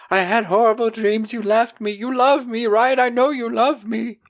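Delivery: high-pitched, panicky mock pleading; mock frantic neediness. Wayne, shaking, clinging to Elaine, mock frantic. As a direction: imitate a clingy man begging for reassurance. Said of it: "He is shaking".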